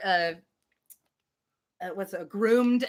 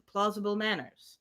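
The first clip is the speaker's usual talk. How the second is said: ordinary delivery, nothing marked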